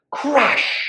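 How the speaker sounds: loud, high burst of a crash; crash sound. A narrator's reading. Storyteller sounds the bin blowing over, sudden loud crash.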